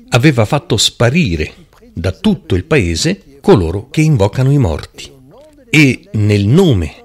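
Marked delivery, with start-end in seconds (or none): none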